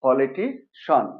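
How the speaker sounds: "পলিটিশন" slowly, poli-ti, then shon; ending as SHON. Shown as a demonstration. Teacher repeats the answer, "পলিটিশন" ending in shon. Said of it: "তাহলে এটা কি?".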